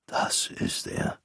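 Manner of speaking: faint, slow dying whisper. A narrator's reading. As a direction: breathe it out like a dying old man. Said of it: "flüsterte er". (geflüstert, langsam und kraftlos wie ein Sterbender)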